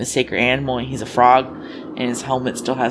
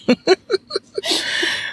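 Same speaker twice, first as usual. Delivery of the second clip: high squealing laugh. Real, not acted. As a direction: burst into a high giggle. Laughing at own joke.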